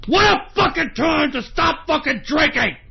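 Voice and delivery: high, worked-up shout; angry yell. Real, not acted. Broadcaster fuming at bad timing, high angry yell. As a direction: repeat it as an exasperated holler. Angry repeat of the same words.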